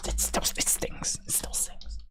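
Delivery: a whisper, hurt but joking. Real, not acted. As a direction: breathe it out in a whisper. Quiet sting of disappointment.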